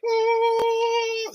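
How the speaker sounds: very high, long amazed oh; surprised gasp. Retold or imitated. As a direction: let it burst out as the doors open. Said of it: "you're like".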